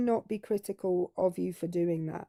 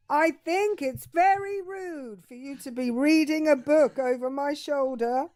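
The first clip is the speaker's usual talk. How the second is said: higher, mock-pompous complaint; affronted husband; 5 semitones higher, 3 dB louder than usual. Husband objects to wife reading, mock-pompous. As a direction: play him huffy and pitched up.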